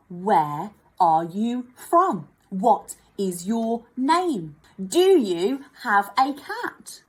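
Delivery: slow, clear asking tune; example questions. Shown as a demonstration. Teacher models example questions for pupils, slow and clear. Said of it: "So I ask a question".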